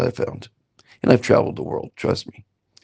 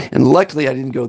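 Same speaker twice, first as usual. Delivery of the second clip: normal talking voice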